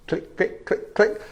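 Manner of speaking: soft whispered clicking noise; reel clicks. Pretend. Relaxed fisherman mimicking the reel, soft whispered clicks. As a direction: mimic a fishing reel ticking. Imitating reel while fishing.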